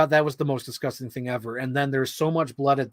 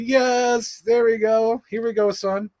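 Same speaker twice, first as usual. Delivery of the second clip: higher, gleeful cheering; 8 semitones higher, slower than usual. Retold or imitated. Cheering on the gore while watching the film, higher and gleeful. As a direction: cheer it on like a fan. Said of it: "It's like".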